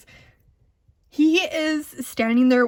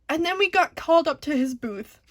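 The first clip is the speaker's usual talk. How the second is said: ordinary delivery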